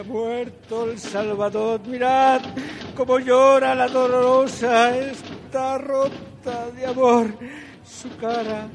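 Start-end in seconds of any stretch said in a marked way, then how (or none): none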